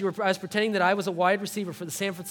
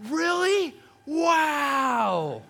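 put-on high, drawn-out kid's wonder; imitating amazed little kid; 8 semitones higher, 3 dB louder, much slower than usual. Little kid handed a hot dog, high-voiced amazement. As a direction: stretch it out in mock childlike awe. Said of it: "he just goes".

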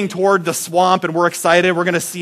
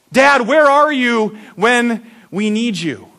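plea for dad; 6 semitones higher, slower than usual. Retold or imitated. Boys wanting father.

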